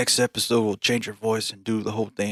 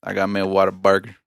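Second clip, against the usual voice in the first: put-on Texan accent; 8 dB louder than usual. Chatting about burgers, loud Texan accent. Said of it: "practicing my Texan accent".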